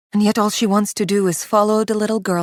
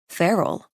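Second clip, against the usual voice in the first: plain reading voice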